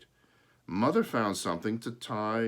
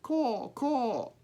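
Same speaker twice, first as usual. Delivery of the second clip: high cawing crow; high crow call; 3 dB louder than usual. A narrator's reading. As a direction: squawk it like a hungry crow. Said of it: "so he said".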